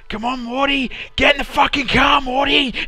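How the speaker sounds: high, loud, shouted Australian Rick yelling from the car. Retold or imitated. Australian Rick honks outside Morty's house, shouting high and loud. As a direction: bellow it like a brash Australian Rick. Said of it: "fat, muscled Rick ... going".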